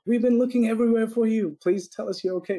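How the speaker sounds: high-pitched, playfully over-concerned appeal; mock worried plea. Joke postcard to a missing client, mock worried plea. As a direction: plead with comic concern, pitched well up.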